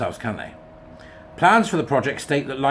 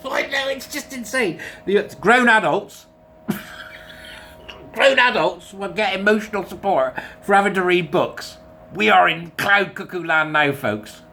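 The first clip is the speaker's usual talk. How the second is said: incredulous; 7 semitones higher than usual. Real, not acted. Incredulous rant about headline.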